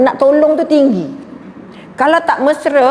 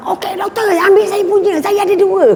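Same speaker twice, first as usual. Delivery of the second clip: high, put-on voice offering a spare card; person in the queue; 9 semitones higher than usual. Man behind in toll queue offering his card, high-pitched. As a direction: chirp it up high like an eager helper. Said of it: "Orang belakang".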